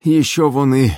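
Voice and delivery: loud, high, effortful gasped words; puffing. A narrator's reading. Gusli player rowing hard, puffing with effort. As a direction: puff it out between strokes. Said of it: "Он пыхтел при каждом движении".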